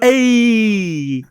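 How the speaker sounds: loud, long drawn-out "ayy"; homeboy "ayy". Shown as a demonstration. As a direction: throw it out like a homeboy call. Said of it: "you just gotta throw in A".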